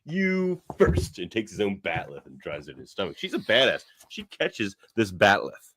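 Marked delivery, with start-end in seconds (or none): 0.1-1.2 drawn-out, much higher mock Dashini; slow cool retort. Dashini killing the cultist, slow smug retort. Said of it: "And she's just like".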